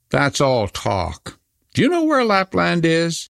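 slow then high sharp question; serious robber girl. Acted. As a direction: dismiss it, then demand to know.

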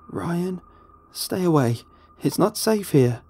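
high-pitched woman's urgent warning; begging. A narrator's reading. Wife warning Ryan of danger, begging. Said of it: "she begged".

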